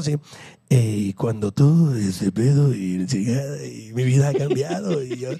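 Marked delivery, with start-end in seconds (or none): 0.7-5.0 mock slow, much lower, dreamy drawl; imitating a calm guy. Mocking the podcast guest's life-changed story, slow and low. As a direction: stretch it out low like a too-peaceful guru. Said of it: "el vato hablaba así".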